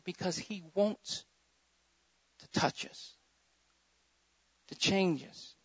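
hushed. Acted. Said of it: "drops to a hush".

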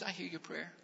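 hushed, near-whispered words of comfort; tender hushed whisper, almost breath; the Lord's gentle answer. Acted. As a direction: speak it softly as the Lord reassuring a child. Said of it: "You know what the Lord's answer is?".

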